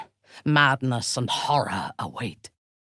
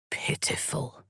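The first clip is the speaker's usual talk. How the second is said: a scornful hushed whisper; contemptuous whisper; 8 dB quieter than usual. Acted. A rival dismissing the player, whispering scorn. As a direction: whisper it with disdain.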